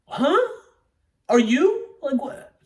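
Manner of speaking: loud, very high-pitched disbelief; baffled. Acted. Acted baffled reaction to the roar.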